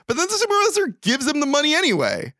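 high-pitched, incredulous recap; baffled. Real, not acted. Host recapping a senseless plot turn, incredulous. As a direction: voice leaps up in comic disbelief.

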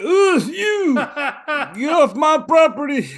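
high, loud, slow laughing geezer shout; imitating a friend's old-man yell, laughing. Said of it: "You're like".

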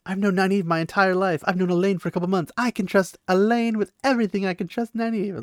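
high, loud mocking singsong; mocking Egwene. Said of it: "you're always like".

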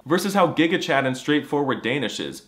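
usual speaking voice

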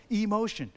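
"Emotion" broken into E and motion; word split out. Shown as a demonstration. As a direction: stretch the first E of "Emotion", then motion. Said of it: "thought of the word motion was an emotion".

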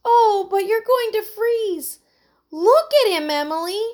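high, worried exclamation; anxious cry. A narrator's reading. Heidi worrying over soaked Buddy, crying out. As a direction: fret loudly in a high voice. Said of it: "cried Heidi".

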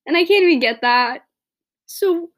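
loud, upset cry of frustration; really pissed off. Real, not acted. Podcaster upset she can't get a simple life, frustrated outburst. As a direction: voice jumps up, emotional and fed up. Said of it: "really pissed off by this".

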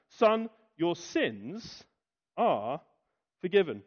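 each word of "Son, your sins are forgiven" drawn out slowly and weighted; solemn slow quote. Shown as a demonstration. Preacher quotes Jesus to the man, slow and weighty. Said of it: "he actually says".

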